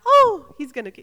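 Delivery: loud, high startled cry; acted-out jolt of fright; retold alarm. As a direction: yelp it in sudden alarm, cutting off. Retold panic at his sudden move.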